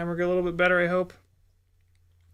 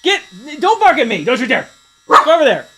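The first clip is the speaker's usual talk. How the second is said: loud, higher stern commands; firm orders to a barking dog; telling Teddy to go greet friends; ordering the dog; 7 semitones higher, 10 dB louder, faster than usual. Real, not acted.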